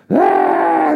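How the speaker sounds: a high, loud whoop of a whole crowd; crowd cheer. Retold or imitated. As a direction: burst out cheering all at once. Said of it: "everybody just goes".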